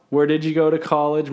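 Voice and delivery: normal talking voice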